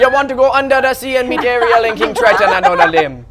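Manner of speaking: high, loud Caribbean crab voice with a laugh; bouncy Sebastian the crab impression. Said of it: "Do a little Sebastian".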